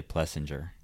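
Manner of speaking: "Plessinger" said "Plessin-jer"; a J sound in "Plessinger"; soft-G pronunciation. Real, not acted. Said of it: "I say Plessinger".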